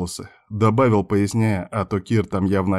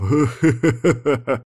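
higher, acted chuckling; guffaw; 5 semitones higher than usual. A narrator's reading. Friend cracking up at his own joke, chuckling. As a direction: chortle in character. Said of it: "заржал в голос".

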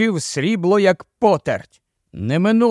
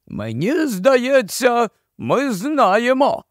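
high, slow, odd marsh-wiggle voice; drawn-out, doleful creature of the marshes; gloomy marsh-wiggle; 7 semitones higher, much slower than usual. A narrator's reading. Puddleglum contradicts Jill, slow high gloomy marsh-wiggle voice.

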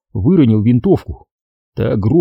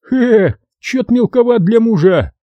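насмешливый солдат; 12 semitones higher than usual. A narrator's reading.

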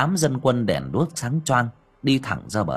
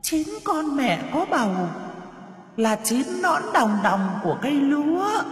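a furious spirit shrieking a riddle; hissing ghost; 12 semitones higher than usual. Acted. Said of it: "rít lên".

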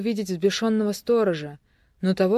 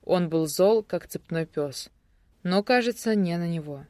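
ordinary delivery, nothing marked

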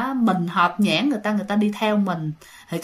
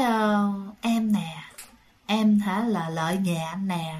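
soft, low, slow dream voice; ghost girl's voice; 3 semitones lower, 5 dB quieter, slower than usual. Retold or imitated. Ghost girl introduces herself in the boy's dream, soft and slow. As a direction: draw it out softly, like a girl in a dream. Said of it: "cái giọng y chang như cái con nhỏ hôm qua".

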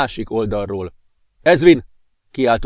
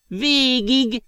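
magasan, lassan, nevetéssel átszőve; önfeledten nevetve mondja; hahotázva; 9 semitones higher, much slower than usual. Acted. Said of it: "hahotázott önfeledten a professzor". (hahotázó öreg professzor)

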